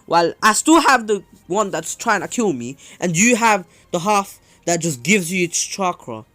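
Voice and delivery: high, loud, incredulous complaint; acted indignation. Kushina protests to Naruto about her Nine Tails half, acted loud indignation. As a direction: protest the unfairness at full voice.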